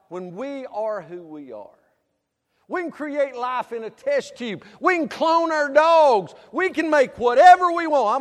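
high, loud hammering sermon delivery; fiery preaching. Acted. Preacher scolding the church for worldly ideas, loud and fervent. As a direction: drive each "we bought into" home.

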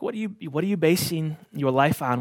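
usual speaking voice

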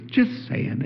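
breathy whisper, wry; whispered aside. Real, not acted. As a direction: toss it off under your breath. Teasing aside to congregation.

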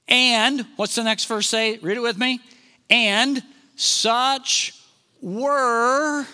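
rousing pulpit delivery to the congregation; fiery preaching. Acted. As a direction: preach it out, rising with conviction. Preaching builds to full force.

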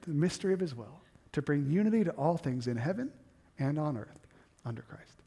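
quiet recitation. Shown as a demonstration. Pastor softly repeating the verse to the congregation, quiet. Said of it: "you just said it three times".

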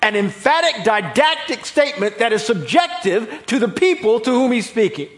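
high, loud pulpit delivery; preaching at full force. Real, not acted. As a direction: drive the point home at full volume.

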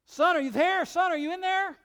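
high, loud calling into the rubble; yelling into the pile. Retold or imitated. Father yelling into the rubble for his son. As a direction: shout down to find the boy. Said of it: "He starts yelling into the pile".

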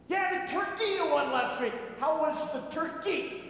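mocking coworker voice; smug coworker. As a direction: needle him about the prank. Coworkers mocking about turkey.

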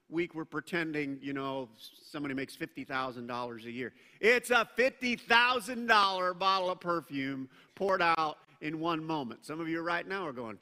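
ordinary delivery

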